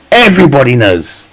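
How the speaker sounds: ordinary talk, nothing marked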